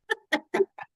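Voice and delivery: loud high cackle; high burst of laughter. Real, not acted. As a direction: laugh out at the memory. Laughing at own story.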